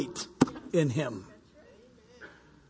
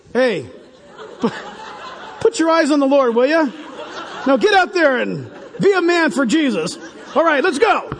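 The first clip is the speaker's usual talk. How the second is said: mock pep talk; 4 semitones higher than usual. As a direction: pump yourself up like a coach, half joking. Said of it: "We ought to do that sometime".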